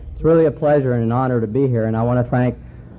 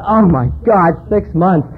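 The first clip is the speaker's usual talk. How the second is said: dismayed; 5 semitones higher, much slower than usual. Retold or imitated. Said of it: "I mean, he's really upset".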